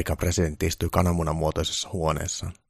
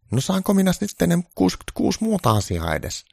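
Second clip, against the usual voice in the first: much higher, loud pleading voice; a needy caricature of Trump; 13 semitones higher, 5 dB louder than usual. Acted. Trump begging Santa for good news, high-pitched.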